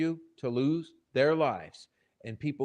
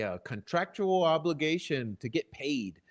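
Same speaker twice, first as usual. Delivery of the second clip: ordinary delivery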